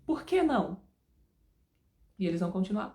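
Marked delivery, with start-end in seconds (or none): 0.0-0.8 put-on higher-pitched, insistent child's protest; acted kid pushing back at a parent; insisting. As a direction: press the question, pitched up. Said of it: "E você insiste".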